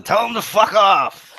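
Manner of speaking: playful mock outrage; mock dismissal. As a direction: call it out high and slow. Jokingly dismissing the friend's phone call.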